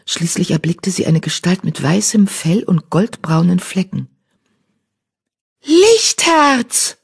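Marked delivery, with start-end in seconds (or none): 5.6-7.0 high, excited call. Acted. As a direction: call the name out to find a friend. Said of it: "rief sie".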